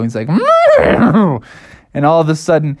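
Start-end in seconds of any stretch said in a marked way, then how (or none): 0.3-1.4 loud, high animal bellow; whinny like a rearing beast; drawn-out cartoon animal noise; bucking bronco cry. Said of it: "like a bucking bronco".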